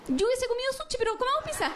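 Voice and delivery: high, sugary fake concession; passive-aggressive sweetness. To her boyfriend over dinner, high sugary fake giving in. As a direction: pretend to give in while guilt-tripping.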